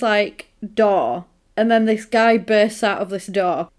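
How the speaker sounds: usual speaking voice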